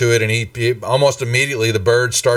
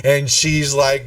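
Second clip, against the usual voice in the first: usual speaking voice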